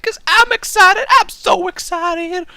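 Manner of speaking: high, loud sing-song excitement; excited. Real, not acted. Youtuber hyping the viewers, loud giddy excitement. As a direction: gush with glee. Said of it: "you better be excited".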